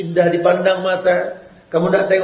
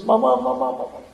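put-on child crying for mama; 5 semitones higher, 5 dB quieter than usual. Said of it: "dia nangis".

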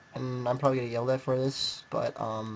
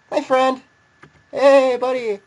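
mock friendly; 10 semitones higher, 10 dB louder, slower than usual. Greeting enemy mockingly.

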